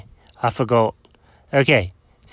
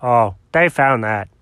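ordinary delivery

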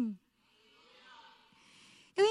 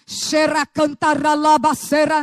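mock tongues; 8 dB louder, slower than usual. Imitating speaking in tongues in prayer.

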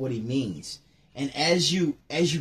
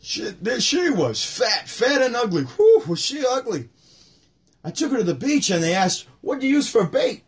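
Rodney joke voice; 8 semitones higher than usual. Retold or imitated. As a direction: ham up the ugly jokes. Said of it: "I go".

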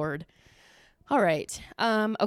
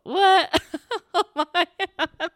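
high, loud outburst breaking into giggles; laughing disbelief; 9 semitones higher, 7 dB louder, slower than usual. Real, not acted. Host stunned by own slip, laughing disbelief. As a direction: crack up in real astonishment.

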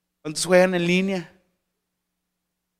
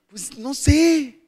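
put-on drawled teen shrug; 7 semitones higher, 5 dB louder, much slower than usual.

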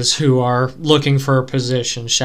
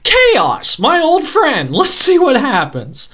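sarcastic mock glee; 8 semitones higher, slower than usual. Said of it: "It's like".

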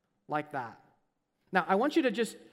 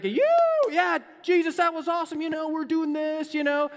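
higher, louder whooping cheer; sports fan cheering; 8 semitones higher, 6 dB louder than usual. Retold or imitated. A crowd member cheers Jesus like a sports fan: excited higher shouting. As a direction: cheer like a fan after a big play. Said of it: "more demonstrative, especially a sports fan".

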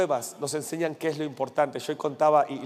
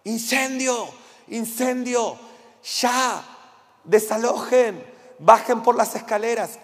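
high urgent yell of fire, louder; shouting; 6 semitones higher, 5 dB louder, slower than usual. Retold or imitated. Pastor retelling a policeman yelling at guests to evacuate, high urgent shouts. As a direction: bark evacuation orders like a cop.

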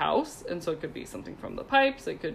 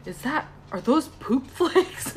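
mock disgusted surprise; 6 semitones higher, slower than usual. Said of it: "He's like".

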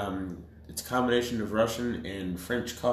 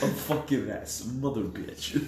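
1 quoting a bad dub; 3 semitones higher than usual. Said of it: "You ever seen that video?".

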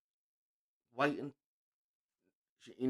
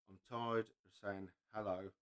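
weary reading; 7 semitones lower, 11 dB quieter than usual. Retold or imitated. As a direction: let each line sink with exhaustion. Reading out a sad meme.

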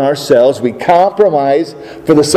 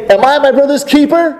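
defiant retort; 9 semitones higher than usual. Acted. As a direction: toss the question back at God defiantly. Cain's defiant retort to God.

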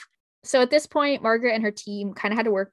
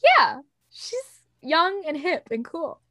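gushing admirer; 7 semitones higher, 4 dB louder, slower than usual. Retold or imitated. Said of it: "you're just like".

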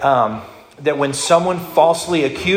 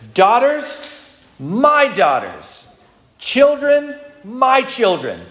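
much higher, wailing lament with each word stretched out; hammed-up despairing cry of a father-in-law losing everything; anguished wailing; 11 semitones higher, much slower than usual. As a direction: wail it like a man tearing out his hair. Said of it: "You can just see him, like, tearing out his hair".

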